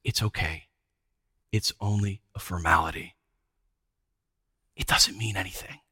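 low, hushed coaxing, fading into a whisper; soft, gentle persuasion with long pauses; loving reassurance. Retold or imitated. Official coaxing the priest to give in, softly and lovingly. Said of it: "puts a hand lovingly on his shoulder and says".

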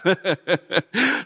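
a delighted laugh at the thought of riches; happy greedy chuckle; gleeful laugh. Acted. Gleeful laugh about the money.

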